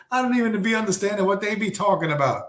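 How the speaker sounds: high, fast whine; hammed-up high-pitched despair; mock tearful complaint. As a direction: imitate a trainee wailing that she's lost. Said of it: "she's crying".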